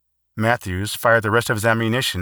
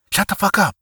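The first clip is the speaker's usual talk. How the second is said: angry hushing; 10 semitones higher, 3 dB louder than usual. Acted. Tense night watch, hushing partner.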